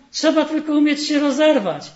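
loud, high, blustering slogan; mock bravado. Sadness mimicking people's tough slogan, loud mock bravado. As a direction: parrot the tough saying mockingly. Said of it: "Mówią".

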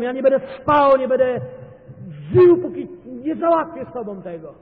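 fervent preacher at the climax; preaching at full force. Acted. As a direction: hammer it from the pulpit. Peak of the sermon.

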